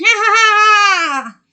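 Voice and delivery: high, loud witch cackle; evil cackle. Acted. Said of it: "And the witch laughed".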